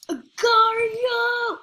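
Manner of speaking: high, slow, drawn-out announcer shout of Agar.io; news announcer call. Pretend. Hammy news report announcing Agar.io with a slow drawn-out high shout. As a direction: boom it out like a news anchor's headline. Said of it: "News report".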